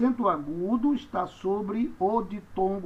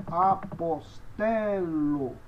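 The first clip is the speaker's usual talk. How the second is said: very slow, syllable by syllable; pronunciation demo; slower than usual. Shown as a demonstration. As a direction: stretch each syllable of "apostelo". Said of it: "tenho aí a pronúncia".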